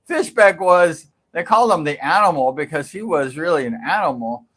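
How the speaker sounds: ordinary talk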